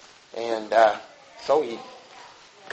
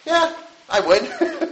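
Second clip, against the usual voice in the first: laughing yes; 11 semitones higher, 5 dB quieter, much slower than usual. Real, not acted. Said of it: "would you rejoice".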